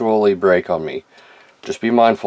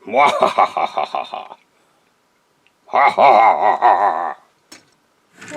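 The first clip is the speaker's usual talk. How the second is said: slow, gloating villain laugh; evil cackle; slower than usual. Acted. Toy skit, villain laughs.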